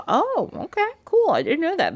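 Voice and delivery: put-on girl feigning awe at the witch; mock-impressed. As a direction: act impressed, pitch way up. Vasilia reacting to Baba Yaga's boast.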